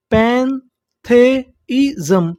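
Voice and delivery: पैंथेइज़म stretched out slowly, rising at the end; slow syllable-by-syllable. Shown as a demonstration. Teacher spells out the new word slowly for students. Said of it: "तो meaning क्या बनी".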